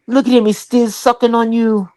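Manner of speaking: high, whiny put-on husband; imitating jealous husband. To her friends, mimicking her husband complaining, high and whiny. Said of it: "And he go".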